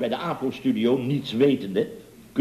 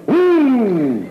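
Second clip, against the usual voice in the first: high loud laughing oeh of disgust; horrified oeh; 9 semitones higher, 8 dB louder than usual. Acted. Mocking singers' faces, high laughing oeh. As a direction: squeal out a shocked oeh.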